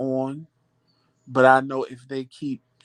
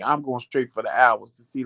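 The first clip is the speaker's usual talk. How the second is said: normal talking voice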